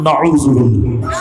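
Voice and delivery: drawn-out preacher call; call-and-response cry. Real, not acted. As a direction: lead the crowd.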